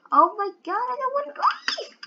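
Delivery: excited squeal that cracks high. Real, not acted. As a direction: let the voice break upward in alarm. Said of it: "What was that voice crack?".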